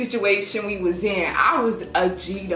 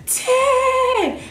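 higher, sing-song call upward. Retold or imitated. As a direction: call the number up to rescuers. Calling up from the elevator shaft.

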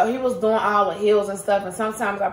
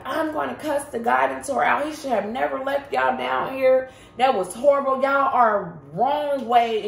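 higher-pitched imitation of an outraged stranger; retold indignant scolding; 6 semitones higher than usual. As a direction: retell his scolding, pitched well up. Retold stranger scolding their guide.

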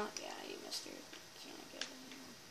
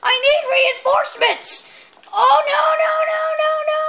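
high, very loud cries for help; panicked shouting; 12 semitones higher, 12 dB louder than usual. Real, not acted. Player needing reinforcements, shouting in panic. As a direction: yell frantically for backup. Calls for help under attack.

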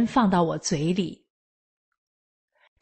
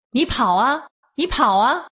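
taunting shout, higher and rising; mock police yell; 5 semitones higher than usual. Policeman taunting the arrested woman, a shouted dare. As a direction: bark a taunt at the prisoner. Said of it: "刘姓警察冲我吼道".

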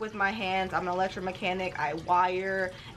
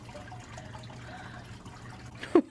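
high unvoiced squeal of a laugh; 6 dB quieter than usual. Real, not acted. Laughing at her doll with a high squeal. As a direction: let a tiny breathy squeal out.

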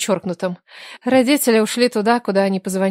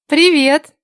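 higher, louder breezy greeting; bright hello; 6 semitones higher, 5 dB louder than usual. Acted. Ignat picks up the phone, bright and open.

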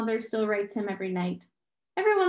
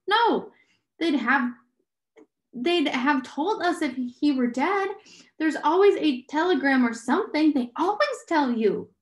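high, emphatic protest; louder, higher, refusing to believe it; distressed protest; 6 semitones higher, 4 dB louder than usual. A narrator's reading. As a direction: insist urgently, voice raised. Meg leaps up, protests father's death.

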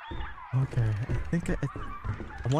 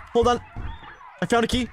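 excited shout; 8 semitones higher than usual. Real, not acted. Excited announcement of finding a key.

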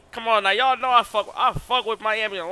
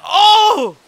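excited high shout; 10 semitones higher, 6 dB louder than usual. Real, not acted. Streamer to chat as Jamaica shows up, excited high shout. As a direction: pitch jumps way up, loud and thrilled.

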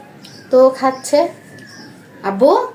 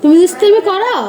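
playful teasing; 6 semitones higher, 6 dB louder than usual. Real, not acted. Mother teasing her little son, high playful sing-song. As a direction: coax the little one with a bright, higher, louder question.

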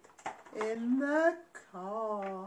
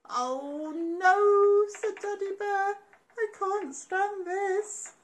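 higher louder wail, slow; exasperated daddy bear; 8 semitones higher, 6 dB louder, slower than usual. Acted. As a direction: groan in despair.

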